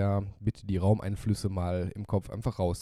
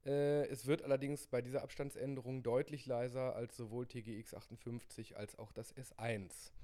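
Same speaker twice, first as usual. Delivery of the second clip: far from the mic, much softer; distant mic; 15 dB quieter than usual. Real, not acted. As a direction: step well back from the mic. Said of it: "Jetzt gehen wir mal wieder auf, ungefähr zwanzig Zentimeter".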